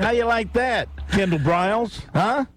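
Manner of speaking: usual speaking voice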